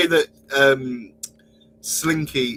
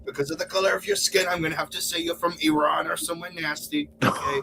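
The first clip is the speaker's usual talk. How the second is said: higher-pitched, hammed-up needling; mock politician impression; 4 semitones higher than usual. Rival answering the president about his birth, mock politician. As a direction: tick off each accusation in a higher put-on voice.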